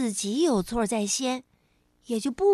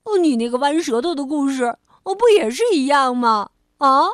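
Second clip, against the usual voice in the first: teasing; 8 dB louder than usual. Acted. Rabbit teasing the frog back.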